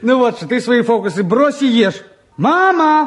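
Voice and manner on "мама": calling Mama from the next room, loud. Real, not acted. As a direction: call for your mother across the flat. Son calls mother from the kitchen.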